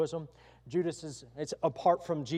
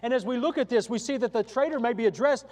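louder, higher impassioned appeal; full-force preaching; 7 semitones higher, 8 dB louder than usual. Acted. Preacher urging the congregation that God still loves them, impassioned and loud. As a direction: plead with sinners to choose Christ.

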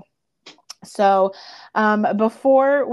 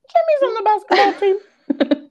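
much higher, mock-shocked reveal; spring the surprise, pitched way up; incredulous; 11 semitones higher, 5 dB louder than usual. Real, not acted. Revealing plot hole, incredulous.

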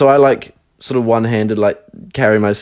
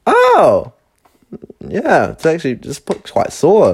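mock casual; 7 semitones higher, slower than usual. Mocking her casual reply.

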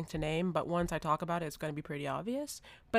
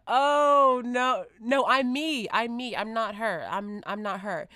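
flustered insisting; 4 semitones higher, 7 dB louder, slower than usual. Retold or imitated. Insisting she's not someone else.

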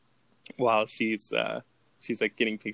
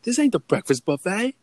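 high-pitched, louder comic challenge; mock confrontational shout; 10 semitones higher, 5 dB louder than usual. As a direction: re-enact a brawler's indignant punchline. Repeats the fight-starting line.

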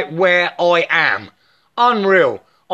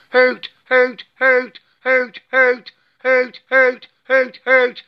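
owl hoots; 6 semitones higher than usual. Pretend. Mock owl hooting in chant.

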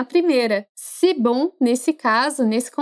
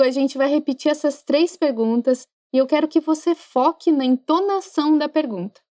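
ordinary talk, nothing marked

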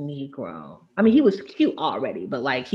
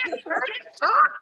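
put-on swooning girls.

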